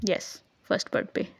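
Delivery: "First birth पे" whispered under the breath as a slip of the tongue; a soft unvoiced mumble of "first birth पे". Real, not acted. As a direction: breathe "first birth पे" almost voicelessly, like a quick mistake. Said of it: "sorry".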